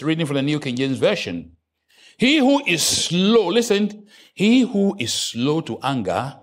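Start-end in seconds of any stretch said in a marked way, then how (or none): 2.2-3.5 slow emphatic reading. Shown as a demonstration. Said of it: "reading scripture". 4.3-6.4 slow emphatic reading. Shown as a demonstration. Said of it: "reading scripture".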